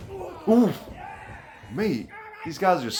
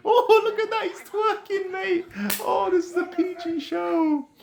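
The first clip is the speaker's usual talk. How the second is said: laughing high. Real, not acted. Wrestler twerking in the ring.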